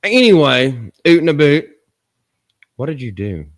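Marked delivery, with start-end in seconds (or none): 1.1-1.7 mock Canadian "oot and a boot" with "oot" and "boot" for "out" and "about". Shown as a demonstration. Slipping in a mock Canadian phrase to change topic. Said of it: "talk like Canadians".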